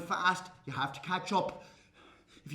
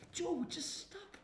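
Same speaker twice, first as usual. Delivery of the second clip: amused pleading; 7 semitones higher, 7 dB quieter, slower than usual. Acted. Wife begs joking husband to stop.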